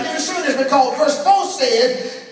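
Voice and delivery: ordinary talk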